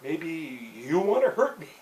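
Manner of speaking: slow, laughing probing question; a laughing shrink's question; mock-analyst dad. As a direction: play the therapist father, half amused. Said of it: "started to say".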